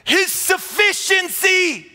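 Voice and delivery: shouted, high, rising preacher's cry; preaching at full force. Acted. Pastor proclaiming Christ to the church, shouting. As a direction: build it to a roaring climax.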